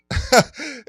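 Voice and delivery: sudden high loud laugh; burst of laughter. Real, not acted. Laughing hard while telling a friend about the weed.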